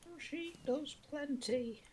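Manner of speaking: high, slow ad jingle voice; advert announcer. Retold or imitated. Quoting a kitchen roll advert to friends, high and slow. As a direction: sing it like a TV ad slogan. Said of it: "That was actually an advert".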